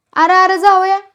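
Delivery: high, loud cry of alarm; shouting. A narrator's reading. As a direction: cry out at the attacking son-in-law. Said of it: "असं संपत्ती ओरडला".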